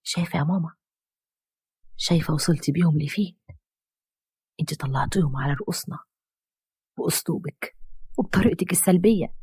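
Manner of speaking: soft, hurt words to her mother; sorrowful reproach. A narrator's reading. Daughter reproaches her mother after the confession, soft and sad. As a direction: quietly blame her with sorrow. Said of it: "بصت لأمها بحزن كبير".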